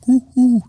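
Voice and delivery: high hooting owl call; imitating an owl: hoo, hoo. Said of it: "owls, like".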